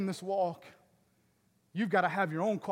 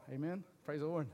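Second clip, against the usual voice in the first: usual speaking voice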